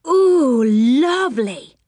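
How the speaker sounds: slow, drawn-out coo of delight; high ooh sliding into a savoured word; delighted relish. A narrator's reading. Joe who loves lollipops, slow delighted exclamation.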